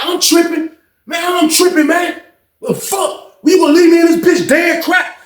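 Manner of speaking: very high, indignant retort; retold heated argument. Nephew fires back at his uncle for leaving him, indignant. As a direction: act out an outraged comeback, voice way up. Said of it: "him and Ronald kinda get loud".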